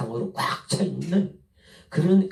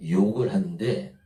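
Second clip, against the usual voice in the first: ordinary delivery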